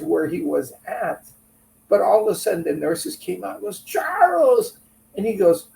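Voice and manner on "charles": high, louder call of his name in surprise. Retold or imitated. Nurses recognizing Charles, calling out his name. Nurses spot him at hospital.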